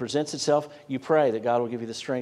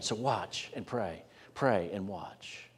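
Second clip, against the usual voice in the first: slow, softer weighted words; slow refrain; 3 semitones lower, 7 dB quieter, much slower than usual. Real, not acted. Preacher urging the congregation to watch and pray, slow and soft. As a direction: let each word land, drawn out.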